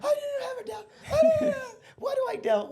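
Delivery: overwhelmed wife. Retold or imitated. Quoting his wife after the refund, high laughing cry. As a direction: voice her breaking with relieved tears. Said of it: "She's like".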